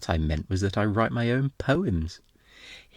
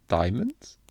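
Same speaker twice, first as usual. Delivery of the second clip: ordinary talk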